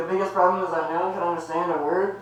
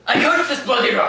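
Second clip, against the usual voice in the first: loud, higher ranting curse at the rock; furious curse; 9 semitones higher, 7 dB louder than usual. Acted. Irish father cursing the rock in a loud rant. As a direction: rage at the ground itself.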